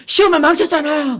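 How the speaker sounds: put-on high, whining voice of a crying teen; a son whining that he flunked; whiny teenage son. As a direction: wail like an upset schoolboy. Son's wail voiced in story.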